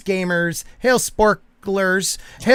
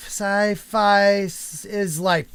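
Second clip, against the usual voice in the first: each word drawn out slowly; slow careful reading; 4 dB quieter, much slower than usual. Shown as a demonstration. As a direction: read the name slowly to get it right. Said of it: "Sci-Fish Life".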